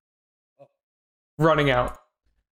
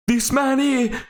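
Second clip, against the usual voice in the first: higher, shaking voice naming the killer; frightened man accusing the killer of his brother; trembling accusation; 8 semitones higher than usual. Acted. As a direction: point and accuse while shaking. Stranger accuses Raven.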